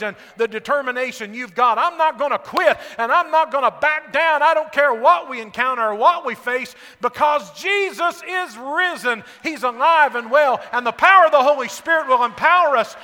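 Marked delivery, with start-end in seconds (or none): 1.8-13.0 louder and higher, shouting resolve; bold declaration. Acted. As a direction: proclaim it like you'll never back down. Disciples voiced, resolute declaration.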